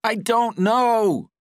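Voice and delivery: high, loud, drawn-out scream; scream of pain. A narrator's reading. Patrick as the lever sends electricity through him, screaming in pain. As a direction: scream it out in agony. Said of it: "Patrick screamed in pain".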